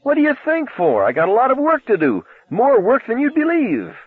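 high, wild and giddy; a crazed young man laughing it off; manic teen voice. Acted. Arnie's manic retort.

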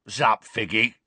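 lightly voiced shady rogue's greeting; casual hello from a scruffy crook. A narrator's reading. Mundungus greets Mrs Figg.

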